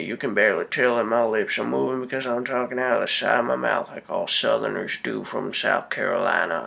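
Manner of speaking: low, mumbled Southern accent impression; mocking a Southern movie star's accent; mock southern drawl. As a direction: talk out the side of your mouth in a lazy Southern drawl. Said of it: "He's still got that stupid southern accent".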